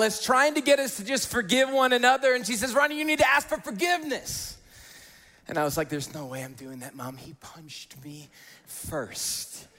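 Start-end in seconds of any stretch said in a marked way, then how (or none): 2.7-4.6 high-pitched mock motherly order; imitated insistent mom. Mom tells son to apologise, imitated insistence. 5.9-9.7 put-on very soft, low kid defiance, slowing right down at the end; quiet stubborn refusal. Boy refuses mom's order to apologise, hushed stubbornness. Child voiced refusing his mom.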